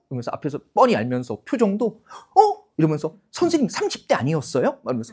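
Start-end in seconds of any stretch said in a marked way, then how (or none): 2.4-2.7 high, loud fake gasp of disbelief; overdone mock astonishment; feigned surprise. Pupil pretending not to know the teacher's age, obviously fake surprise. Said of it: "너무 티가 나니까". 3.3-4.8 high-pitched, transparently put-on disbelief; feigned surprise. Glib pupil flattering the teacher about age, feigned surprise. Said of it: "너무 티가 나니까".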